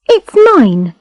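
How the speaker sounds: put-on high, defensive girl voice; a touch of possessive haste; quick, defensive. A narrator's reading. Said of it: "she said quickly".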